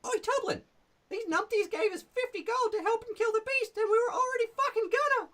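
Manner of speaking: brash goblin challenging strangers at the bridge. Shown as a demonstration. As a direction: squeak it out like a rude little goblin guard. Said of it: "If they paid them".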